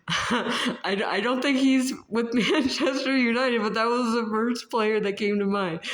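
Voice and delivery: real laugh breaking into the line; amused at her own mix-up, laughing. Real, not acted.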